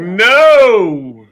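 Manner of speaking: sudden loud panicked no; shouted no. Retold or imitated. Reliving missing the last stair, a sudden loud no. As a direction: yell it like you just missed a step.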